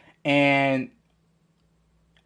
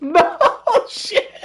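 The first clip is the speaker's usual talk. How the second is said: shocked shout. Retold or imitated. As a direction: yell out in horror at the mess. Friend vomits in the van.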